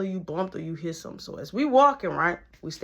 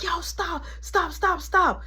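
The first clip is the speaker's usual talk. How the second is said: imitating girl yelling; 11 semitones higher, slower than usual. Sydney breaking up fight.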